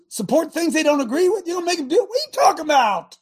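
high, indignant shouting rebuke; scolding shout. Acted. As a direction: shame them into courage, voice pitched high. David shouting at cowardly Israelites.

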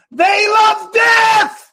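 "They love death" shouted very high and slow, "death" held; heated shout. Real, not acted. Host at full fury against abortion, shouting. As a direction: roar out "They love death" with "death" stretched long. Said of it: "Bo, I'm hot today".

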